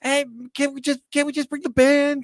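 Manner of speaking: high pleading put-on voice; pleading Hagen. Cohost mimics Hagen begging to bring the band, high pleading voice.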